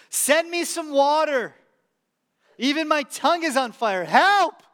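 begging for water, building to a shout on Help; desperate cry. Retold or imitated. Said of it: "Look at what the rich man says".

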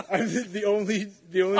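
put-on German villain accent; a hint of a German movie villain. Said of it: "I just really wanted to do a German accent".

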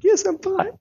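high squeaky insistence, hiding tears; squeaky mock fine. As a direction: pretend to be fine in a thin voice. Crying dad reassuring daughter.

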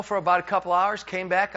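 ordinary delivery

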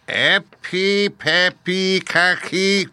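high, drawn-out witch's spell; slow incantation. A narrator's reading. Witch casting on her left foot, slowly intoned. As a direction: intone each magic word slowly, pitched high. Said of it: "and said slowly".

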